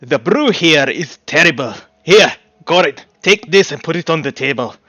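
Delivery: put-on loud dwarf in a Scottish accent. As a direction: bellow it like a Scottish innkeeper dwarf. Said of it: "apologies for butchering the accent".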